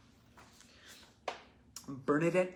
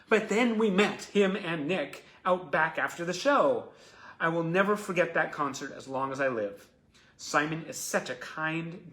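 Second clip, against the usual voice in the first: animated, emphatic read-aloud; fan letter reading; 4 semitones higher, 10 dB louder than usual. Real, not acted. Host reads Sharon's letter about meeting Simon, animated letter reading. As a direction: read it out with a fan's gushing devotion.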